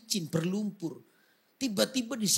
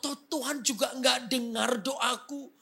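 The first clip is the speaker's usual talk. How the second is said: grumbling at God; 8 semitones higher than usual. Retold or imitated. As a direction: rant at God, half shouting. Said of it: "Dia ngomel-ngomel dengan Tuhan".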